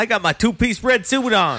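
high loud playful boast; loud mock announcement. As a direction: belt it out proudly in fun. Jokey loud boast during film.

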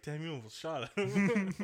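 mock awe walking out; lightly voiced buddies; awed friends. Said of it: "they're like".

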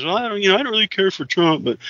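mock the man's confused rant; drunk man voice.

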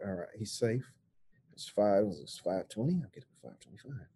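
low, very soft murmur; hushed self-talk. Acted. Parent checking the clock, hushed to himself. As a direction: think aloud without waking the child.

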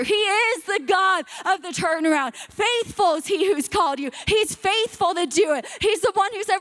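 rising fervent preaching; fervent sermon climax; preaching at full force. Acted. Preaching builds.

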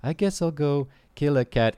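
the suitor with a maniacal gleam; maniacal guy. Acted. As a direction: toss the line out creepily. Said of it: "It's like".

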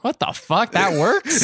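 high, incredulous outburst; high-pitched baffled exclamation; amused disbelief. Real, not acted. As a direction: question it in laughing astonishment. Disbelief at cringy pickup working.

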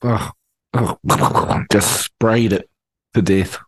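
usual speaking voice

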